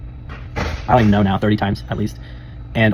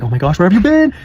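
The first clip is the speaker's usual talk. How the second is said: high, bursting with joy; excited puppy greeting; 9 semitones higher, 4 dB louder than usual. Retold or imitated. Reunion after death, squealing with excitement. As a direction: greet him like an overjoyed puppy. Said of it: "I was getting excited".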